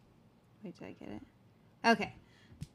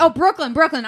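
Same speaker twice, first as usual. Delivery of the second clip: louder, higher self-correction; 8 semitones higher, 12 dB louder than usual. Real, not acted. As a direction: call out the right word with a laugh. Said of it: "I'm sorry".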